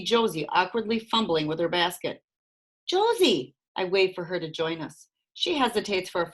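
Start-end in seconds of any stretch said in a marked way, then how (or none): 2.9-3.5 higher call across the platform; calling a name across the platform. A narrator's reading. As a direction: call her over. Calling to Josie.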